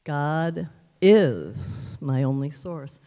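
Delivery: "is" stretched long and set apart by pauses; stress on is. Shown as a demonstration. Showing how to change the inflection, stress on "is". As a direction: lean hard on a long, drawn-out "is". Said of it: "change the inflection on the word".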